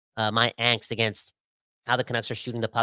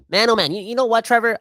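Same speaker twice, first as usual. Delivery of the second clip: much higher, louder, fired up; worked-up outburst; 11 semitones higher, 6 dB louder than usual. Real, not acted. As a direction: burst out, all riled up. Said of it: "I'm so emotional".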